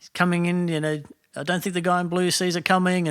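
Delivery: lightly acted game commentary; game caster commentary. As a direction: call the play like a streamer. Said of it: "talk through somebody else's game".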